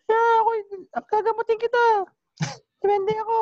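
very high squeaky little goblin voice, loud; mock duende healer voice, squeaked high; tiny duende voice. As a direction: shrink your voice down like a tiny dwarf spirit. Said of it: "Ang dali-daling gaya ng boses nun eh. Paliitin mo lang eh".

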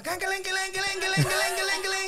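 high ringing can que len, fast and rhythmic; cowbell imitation. Proud dad at volleyball mimicking a cowbell. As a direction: ring it out like a cowbell at the game. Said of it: "yo soy de campana".

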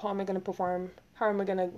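usual speaking voice

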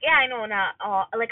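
ordinary talk, nothing marked

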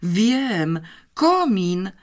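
light up with a sudden idea; lively eureka moment; animated. A narrator's reading. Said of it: "natychmiast ożywiła się".

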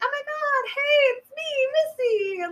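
a friend overjoyed at the reveal; excited squeal. Retold or imitated. Missy springing her prank.

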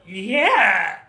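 high, loud hype-man "yeah!". Retold or imitated. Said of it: "You sound like [name]".

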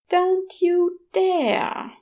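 slow, high warning with a mock growl; growled threat. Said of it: "the angel growled".

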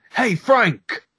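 high, punchy mock shout; hammed-up shouty policeman calling out; imitated angry yell. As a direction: yell after Frank like an irate American cop. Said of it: "he yells like the most".

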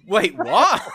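ordinary talk

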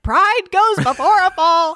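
high, loud, slow scolding mother; stern mom. Retold or imitated. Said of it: "my mom would be like".

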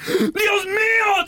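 very high, drawn-out wail; hammed-up cry of alarm; mock horror. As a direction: imitate an overdramatic passenger's shriek. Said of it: "cuando te dicen".

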